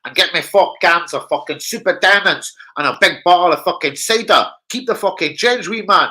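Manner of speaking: loud, high mock Scottish kid; Scottish schoolkid. Schoolkids sending a wino to the off licence, mock Scottish voice. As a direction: put on a cocky Scottish kid's voice. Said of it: "they'd ask one of the little winos".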